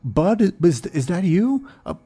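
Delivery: high, fast, panicked plea; pleading captive. Acted. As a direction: beg desperately to be freed. Captive John pleads for rescue.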